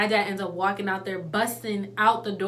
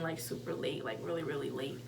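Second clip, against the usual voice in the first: hushed; 7 semitones lower, 13 dB quieter than usual. Real, not acted. Hushed aside about late hour.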